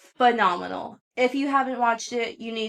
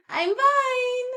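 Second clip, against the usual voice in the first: I'm fine squeaked much higher, laughing; high laughing insistence on fine; mock-cheery I'm fine. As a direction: chirp fine as a laughing fake reassurance. Joke about sobbing then being fine.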